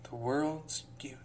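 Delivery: breathy whispered echo. Real, not acted. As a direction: whisper it back to yourself.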